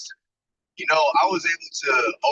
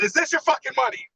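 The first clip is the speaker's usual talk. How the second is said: retold angry rant; 9 semitones higher than usual. Retold yelling at bank rep.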